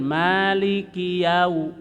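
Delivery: Maliki yau- slow, ki running straight into yau; no tanwin. Shown as a demonstration. Teacher repeats the right link slowly. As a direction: join Maliki to yau without a hum. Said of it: "Itu tidak ada tanwin".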